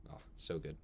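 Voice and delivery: ordinary delivery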